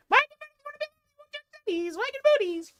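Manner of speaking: squeaky high coo to the pup. Real, not acted. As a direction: coax the dog in a high cooing voice. Cooing at dog to wag.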